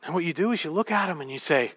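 normal talking voice

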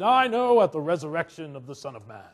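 low, solemn piety; mock pious. Preacher imitating the sisters answering Jesus, mock pious. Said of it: "automatically got pious and said".

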